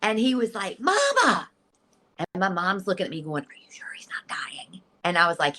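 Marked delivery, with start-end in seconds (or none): 0.8-1.5 high, amazed call to mother; high-pitched cry of recognition; excited. Retold or imitated. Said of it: "gasping and is so excited". 3.4-4.8 hushed, worried aside; soft, voiceless retold question; mom's whisper. Mom whispers worriedly at deathbed.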